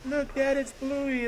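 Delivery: put-on high kid voice calling to dad; imitating excited kid. Kids in the ad calling to their dad, high and excited. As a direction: squeal it up to Dad like a thrilled child. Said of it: "they're like".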